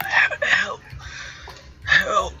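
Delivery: thin, high, feeble call for help; faint voice calling out from the dark; weak cry for help. Acted. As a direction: call out weakly, as if barely able to. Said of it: "You hear a very weak sort of voice".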